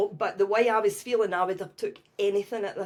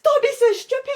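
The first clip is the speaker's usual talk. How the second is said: much higher and louder, scoffing; mock outraged shout; 9 dB louder, faster than usual. Telling her son off, high and loud. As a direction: throw it back at him in disbelief.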